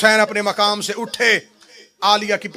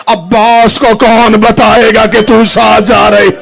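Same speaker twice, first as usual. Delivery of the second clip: loud, higher, slow wail of grief; anguished cry; 6 semitones higher, 10 dB louder than usual. Acted. Preacher to mourners at the climax, loud wailing cry. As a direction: cry out the lament at full force.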